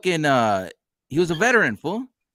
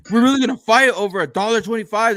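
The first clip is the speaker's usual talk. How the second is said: higher, louder mock disbelief; retold indignant protest; 6 semitones higher, 6 dB louder than usual. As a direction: imitate an indignant challenge over something petty. Retold rider protests the fight.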